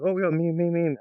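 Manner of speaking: hammed-up crowd excitement; mock hyped crowd. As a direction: fans cheering in mock enthusiasm. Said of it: "People are like".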